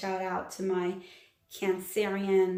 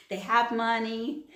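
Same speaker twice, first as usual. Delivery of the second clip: laughing. Real, not acted. Laughs at own remark.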